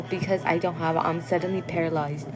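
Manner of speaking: usual speaking voice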